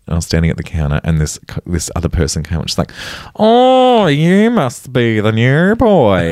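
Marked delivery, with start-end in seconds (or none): 3.3-6.3 much higher, slow, broad Melbourne suburban voice; imitating a co-worker's high, broad Kath and Kim voice; Kath and Kim accent. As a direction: sing it out like a Fountain Lakes mum. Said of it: "we're doing Kath and Kim accents".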